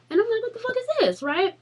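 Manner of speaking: high, disbelieving squeal at the box; high-pitched what-the-hell; shocked outcry. Retold or imitated. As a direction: sound floored by what you just opened. Opening the surprise box.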